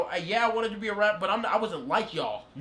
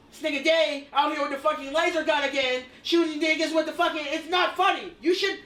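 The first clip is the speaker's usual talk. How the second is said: mock annoyed rant; 9 semitones higher than usual. Real, not acted. Ranting at Day's laser gun.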